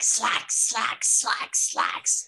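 whispered taunt, word by word; insistent mock-sinister whisper; teasing. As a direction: creep out a friend with a hated word. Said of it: "we were teasing each other".

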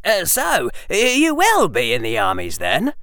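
put-on high, stumbling boyish eagerness; suddenly enthusiastic. Harry learning Hermione will join the armies, enthusiastic. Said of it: "Harry's voice was suddenly enthusiastic".

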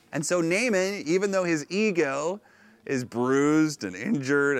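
normal talking voice

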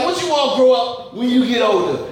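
ordinary talk

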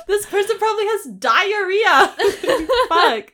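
loud, high, laughing protest; laughing outburst. Real, not acted. Co-host sticks up for the knocker, loud and laughing. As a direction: protest loudly through laughter. Listener laughs while heckling.

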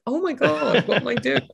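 high, laughing "what am I doing"; panicked and laughing at yourself; flustered panic. Retold or imitated. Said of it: "I'm like".

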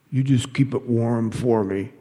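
slow, thick and heavy voice. Acted. The hitman on the phone, in a slow thick voice. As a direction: let the words come thick and slow. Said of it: "his voice thick with something".

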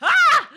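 high, loud squeal of triumph; sudden high yelp of joy; delighted shriek. Real, not acted. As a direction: shriek with surprise at your success. Delight that the beast obeyed.

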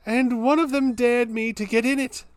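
high, put-on Cockney accent. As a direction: read it like a Cockney Londoner. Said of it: "Can you do it in a Cockney accent?".

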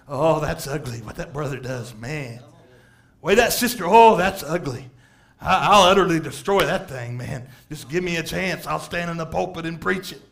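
low, smug mock voice of a judgmental brother; lightly voiced smug hypocrite; mock self-righteous churchgoer. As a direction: sneer at others' sins like a church gossip.

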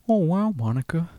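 imitating Owen Wilson; Owen Wilson as Clinton's friend. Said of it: "Owen Wilson and Bill Clinton".

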